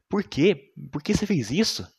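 high, loud shocked questioning; shocked police question. Retold or imitated. Police shocked, asking the killer why he did it, high and loud. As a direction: demand to know why, in disbelief.

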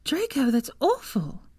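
slow, high cry of revulsion; horrified. A narrator's reading. Hermione reacts to his scars, slow and horrified. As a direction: let the horror stretch each word. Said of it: "looked at him in revulsion".

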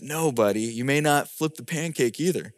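low, soft fatherly no; calm refusal. Retold or imitated. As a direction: keep it quiet and low with the child. Father refuses son once more.

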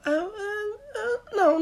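high fake sobbing wail; hammed-up crying whimper; mock wailing. Mocking Portuguese who give up, hammed-up sobbing wail. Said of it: "somos assim para tudo".

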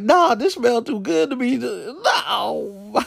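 high, whiny no with a fake cry; mock despair. Refusing to believe it's freshener, mock crying. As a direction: whine in disbelief. Denying the truth in dismay.

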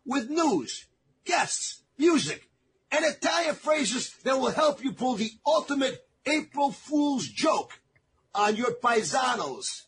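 high, bright announcer read over music; radio announcer intro. Acted. Host opens the show to listeners, announcer voice. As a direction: hype up the show opener.

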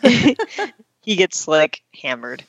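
laughing through the words; giggling as she says hammered. Real, not acted. Laughing while retelling drunk Chandler.

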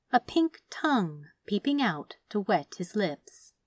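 ordinary delivery, nothing marked

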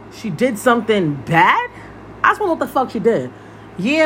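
ordinary talk, nothing marked

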